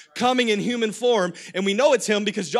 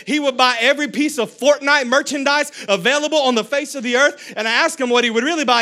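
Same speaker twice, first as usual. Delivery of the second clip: ordinary talk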